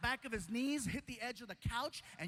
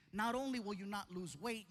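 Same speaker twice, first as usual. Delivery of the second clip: ordinary delivery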